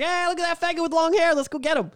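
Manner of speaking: put-on high-pitched jeering kid voice; imitated bully call. Bullies picking out their target, high mocking call.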